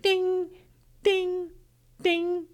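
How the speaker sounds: high sung ding, ding, ding; imitated seatbelt ding. Friend mimicking the car's seatbelt chime, high and sing-song. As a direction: chime each ding high like a car alarm.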